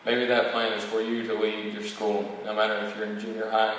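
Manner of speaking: ordinary delivery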